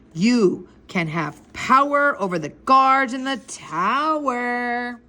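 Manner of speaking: slow, drawn-out tempting pitch; mysterious enticement. Acted. Older boy promising a kid power over guards, mysterious enticement. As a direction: stretch the words to lure the kid in.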